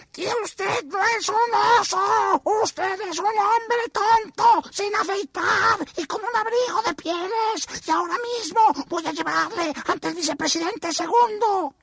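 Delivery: muy agudo, voz de jefe enfadado. Acted. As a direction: reprende con altanería aguda. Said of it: "aún más enfadado". (vicepresidente muy enfadado)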